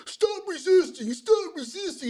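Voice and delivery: high, drawn-out mock cop yell; mocking high-pitched arrest order; retold police command. Mimics police during an arrest.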